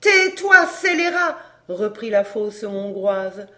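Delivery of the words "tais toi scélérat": high, loud outburst; furious silencing. A narrator's reading. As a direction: shut the scoundrel up in a rage. Scorned mistress silencing her lover. (ordre furieux de se taire)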